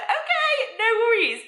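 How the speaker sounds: over-eager agreement. Retold or imitated. Speaker accepts one show for now, high squeaky agreement. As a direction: squeak out a mock-casual agreement.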